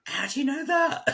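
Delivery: mock Southern American astonishment; imitating a Carolina accent. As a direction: play the surprised guy from Carolina. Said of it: "That's Carolina".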